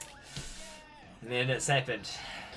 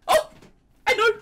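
excited; 6 dB louder than usual. Real, not acted. Sudden idea for comment question.

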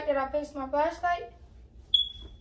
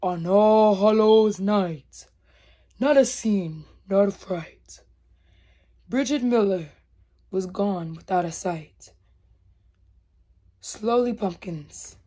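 whispered-low scary story narration; spooky storytelling; 6 semitones lower, slower than usual. Acted. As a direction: hush it down like a campfire tale.

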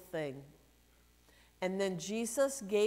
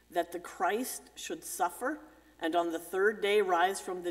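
solemn, measured gospel reading; scripture reading; 4 semitones lower than usual. Acted. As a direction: read it like a lector at Mass. Reads the gospel aloud.

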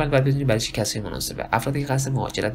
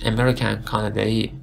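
ordinary delivery

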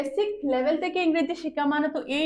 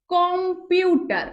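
"Computer" slow and higher, as কম, পিউ, টার; three beats; 4 semitones higher, 3 dB louder, much slower than usual. Shown as a demonstration. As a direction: stretch "computer" over three syllables. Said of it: "তিনটা ঝুঁকে উচ্চারণ করেছি". ("Computer" ধীরে, তিন ঝোঁকে)

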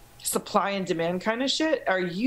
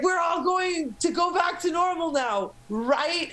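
mock naive hope; 10 semitones higher, slower than usual. Mocking own earlier naive hope.

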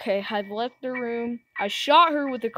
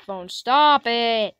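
frustrated; 8 dB louder, slower than usual. Real, not acted. Frustrated at laggy phone.

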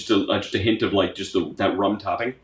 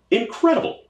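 much higher, rising incredible; squealing excitement; 13 semitones higher than usual. Real, not acted. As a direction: let incredible soar up in delight. Praising the designer, repeated.

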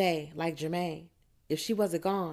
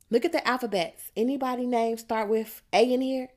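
higher, louder, instructing the group; loud quizzing; 6 semitones higher, 4 dB louder than usual. A narrator's reading. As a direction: call it out like a classroom helper. Rhonda quizzes group on the alphabet.

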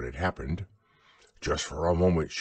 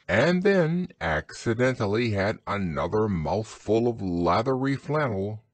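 plain reading voice

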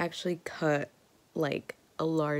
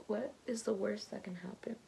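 resigned whisper; 3 semitones higher, 6 dB quieter than usual. Real, not acted. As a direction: whisper it, giving up.

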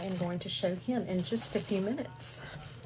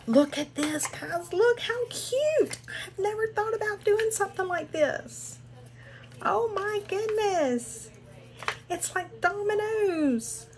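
much higher and louder, gushing with delight; excited squeal; 12 semitones higher, 4 dB louder than usual. Real, not acted. Unboxing a friend's gift, squealing with delight.

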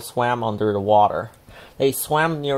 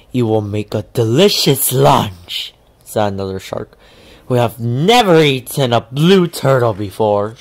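louder, menacing; a hungry shark gloating; lightly voiced mean shark; threatening shark; 5 dB louder than usual. A narrator's reading. Another shark.

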